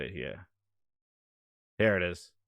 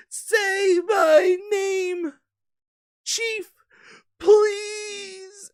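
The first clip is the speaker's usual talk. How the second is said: much higher, slow, shouted sobbing beg; hammed-up crying plea; 4 dB louder, much slower than usual. Bootleg Joel begs Chief in the parody ending, fake sobbing shout. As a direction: wail it like a tragic ghost, mock crying.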